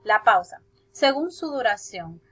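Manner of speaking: usual speaking voice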